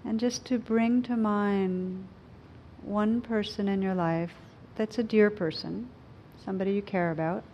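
guided meditation. Acted. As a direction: gently guide them inward.

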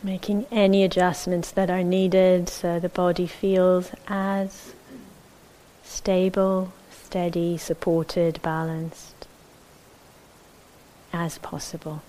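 calm, measured meditation guidance with long silences; unhurried, even voice leaving space between phrases; guided meditation. Acted. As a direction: settle listeners into the body, phrase by phrase.

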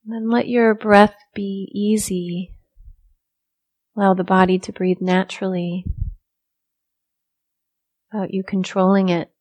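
soft, unhurried meditation guidance with long silences; guided meditation. Real, not acted. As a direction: ease listeners into stillness, softly, leaving room to breathe.

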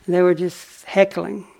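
usual speaking voice

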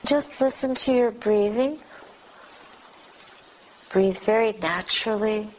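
ordinary talk, nothing marked

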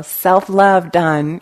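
slow, drawn-out mock announcement; smug checklist finish; mock satisfied. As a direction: drag it out like ticking a box.

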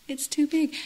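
a toned-down mock wail; lightly voiced boy. Boy overwhelmed by the outdoors, mild mock cry.

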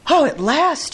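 high, relieved exclamation; joyful shout. A narrator's reading. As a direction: exclaim it high with storybook delight. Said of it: "shouted Toad".

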